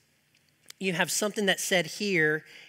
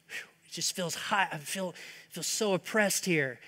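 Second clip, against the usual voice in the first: oppressed sigh; 7 dB quieter than usual. Acted. Voicing Elijah's oppression.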